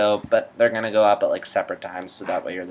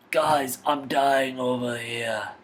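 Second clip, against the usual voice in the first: dwarf king; 4 semitones higher, slower than usual. Acted. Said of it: "The Hobbit".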